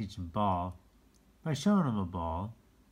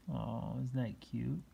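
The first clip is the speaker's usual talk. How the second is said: usual speaking voice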